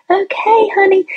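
lifted high, soothing "Okay, honey" like a kindly nurse; gentle, high nurse's coo; sweet nurse voice. Retold or imitated. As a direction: imitate the sweet little nurse comforting a patient. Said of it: "this sweet little nurse".

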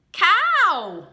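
loud, high reveal of the answer; big reveal. Acted. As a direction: call the word out high and loud. Delivers the awaited rhyme word.

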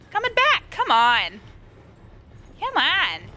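alarmed exclamation. Real, not acted. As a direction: pitch jumps up in alarm. Monster returns, player exclaims.

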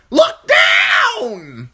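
full-force screech, very loud; commanding crow. A narrator's reading. Crow overriding Bran's fear, screeched command. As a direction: scream the order, stretching DOWN.

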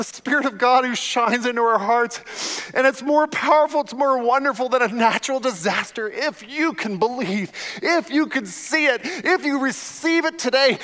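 impassioned altar-call preaching; preaching at full force. Real, not acted. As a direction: call them forward with fervour.

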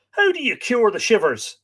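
high, loud demand. A narrator's reading. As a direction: demand an answer from a servant. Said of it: "his master demanded".

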